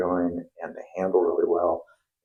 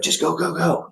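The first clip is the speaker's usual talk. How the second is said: high, pushy go go go; impatient boss; 10 semitones higher than usual. Retold or imitated. Cousin hurrying the plumber out the door, high mock impatience. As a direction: imitate a cousin brushing off questions, pitched up. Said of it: "And he goes".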